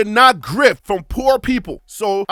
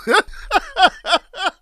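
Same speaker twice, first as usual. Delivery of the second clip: high laugh; 7 semitones higher than usual. Real, not acted. Laughing at his own joke.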